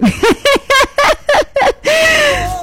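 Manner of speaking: real laughter at her own joke; burst of laughter. Real, not acted. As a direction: break out in a shrieking laugh. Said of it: "Van a disculpar que yo me ría así".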